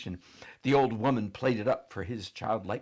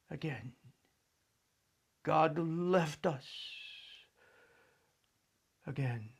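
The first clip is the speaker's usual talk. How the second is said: sorrowful; 7 semitones higher than usual. A narrator's reading. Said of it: "chin quivered again".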